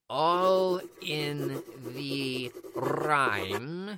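deep, slow, echoing repeat; echoing whisper. Acted. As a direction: let it ring like an echo. Echoing mystic repeat with music.

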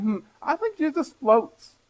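high, slow dreamy musing; mock naive reader. Preacher mocking a reader's naive idea, high and dreamy. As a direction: play the wide-eyed reader picturing a floating Jesus. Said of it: "you start thinking".

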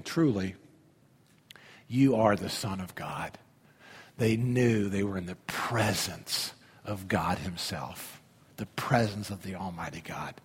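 awed whisper of worship; hushed awe. Acted. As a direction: say it in wonder, quietly.

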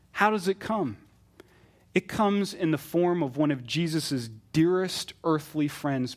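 ordinary delivery

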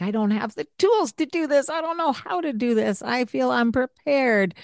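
high, fast, flustered parent voice; anxious parent inner voice. Retold or imitated. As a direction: rattle it off like an overwhelmed parent.

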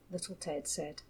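plain reading voice

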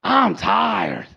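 mock groggy, whiny complaining voice; tired churchgoer. Sleepy churchgoer on Sunday morning, mock whiny grumble. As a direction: grumble like someone who'd rather sleep in. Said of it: "you go".

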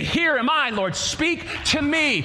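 high, slow plea to the Lord; crying out in prayer. Pretend. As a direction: pray it slowly, reaching up. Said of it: "I can cry before the Lord and say".

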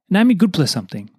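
normal talking voice

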